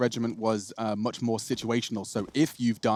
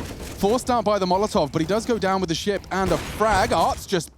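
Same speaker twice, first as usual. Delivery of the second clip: raised, driving esports caster voice; live play-by-play; 7 semitones higher, 5 dB louder than usual. Real, not acted. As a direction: call the kills as they happen. Calls live esports round.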